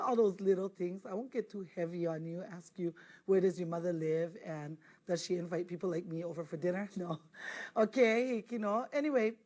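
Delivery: ordinary talk, nothing marked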